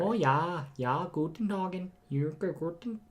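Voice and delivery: high, slow, soft mock German; German accent. Shown as a demonstration. Friend has another go at the accent, faltering German accent. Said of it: "tried to do a German accent".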